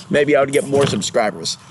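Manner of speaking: ordinary talk, nothing marked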